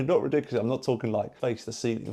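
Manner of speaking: normal talking voice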